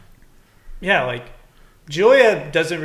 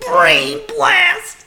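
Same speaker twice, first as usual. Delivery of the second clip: much higher squeaky cry; shouted catchphrase; 5 dB louder than usual. Pretend. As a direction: yell it like a cartoon kid.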